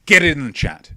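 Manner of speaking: loud call to chat. Real, not acted. Host urges viewers to post in the chat, loud. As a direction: shout it out to the viewers.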